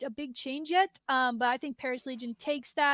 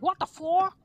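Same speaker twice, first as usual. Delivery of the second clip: put-on a lightly done, louder Crim Six accent, higher; a failed Crim Six accent, blurted out; 4 semitones higher, 5 dB louder than usual. Mocking a rival pro, a clumsy Crim Six accent. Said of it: "I can't do a Crim Six accent".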